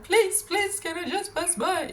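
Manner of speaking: high, frightened begging; pleading. Retold or imitated. Retelling asking the scary man to let her pass, high frightened plea. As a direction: beg him to let you pass, voice way up. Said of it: "I was trying to".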